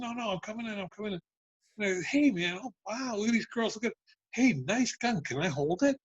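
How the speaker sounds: high, light mock-casual patter; re-enacted coaxing. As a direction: playact easing your way into a tense room, pitched high. Replays talking his way in.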